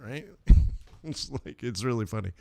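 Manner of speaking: ordinary delivery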